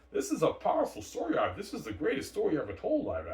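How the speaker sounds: very high, loud mock rapture; hammed-up director awe; awestruck gushing. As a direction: swoon over the greatest story ever. Director gushing about the story.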